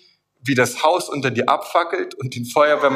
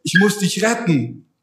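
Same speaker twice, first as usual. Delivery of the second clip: higher, insistent call to follow; urgent masked rescuer; 5 semitones higher, slower than usual. Acted. Masked stranger waking a sleeper to rescue him, urgent. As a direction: play the rescuer pressing a sleeper to come along. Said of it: "Er nuschelt und sagt".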